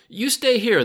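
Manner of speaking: high, very loud, jubilant order; joyous shout. A narrator's reading. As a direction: shout it out with delight. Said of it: "the balding man shouted joyously".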